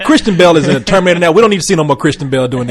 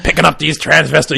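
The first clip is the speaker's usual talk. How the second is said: lightly voiced crotchety grumble; a hint of a disgruntled old cop; Nick Nolte; 3 dB quieter than usual. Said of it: "just old and crotchety".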